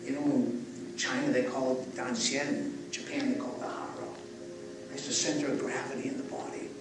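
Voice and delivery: soft, calm breathwork guidance; guided breathing. Acted. Leads a breathing exercise.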